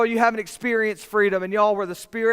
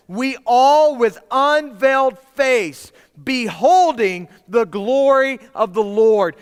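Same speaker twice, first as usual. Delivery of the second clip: emphatic preaching; 3 semitones higher, 4 dB louder, slower than usual. Acted. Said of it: "I love what he says here".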